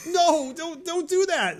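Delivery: high, panicked no don't; high-pitched alarmed warning at the TV; alarmed plea. Retold or imitated. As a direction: squeal it at the screen like a worried viewer. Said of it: "I'm like".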